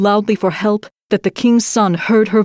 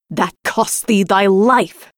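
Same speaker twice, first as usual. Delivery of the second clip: slow, menacing threat from the false bride; a slow cold threat; cried out; slower than usual. A narrator's reading. False bride threatens the maid, slow and menacing. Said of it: "cried the bride".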